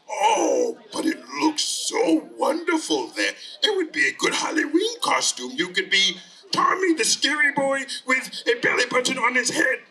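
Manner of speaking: cartoon creature selling an idea; coaxing monster. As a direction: gush over it to win the boy round. Monster coaxes Tommy.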